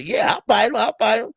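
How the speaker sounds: loud, high, eager acceptance; eager fighter. Retold or imitated. Fighter accepting a risky match, loud eager yes. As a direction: jump at the fight like a cocky fighter. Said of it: "a fighter gonna say".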